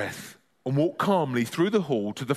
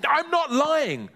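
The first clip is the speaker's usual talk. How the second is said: crying out; 13 semitones higher, slower than usual. Acted. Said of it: "cried Ben".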